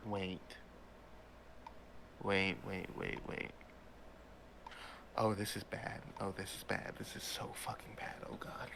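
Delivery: hushed, dropping into a panicked whisper; Pam panicking. Acted. Pam realising this is bad, hushed panic. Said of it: "see Pam begin to panic".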